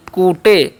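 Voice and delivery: कूटे said as "koote", slowly as a mistake; drawn-out wrong कूटे; wrong reading. Shown as a demonstration. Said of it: "इसे कूटे नहीं पढ़िएगा". (गलत उच्चारण कूटे)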